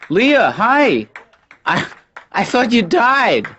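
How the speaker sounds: high, loud call across the room; loud, high-pitched astonished shout; surprised greeting. Acted. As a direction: hail an old acquaintance in startled delight. Calling out to an old acquaintance.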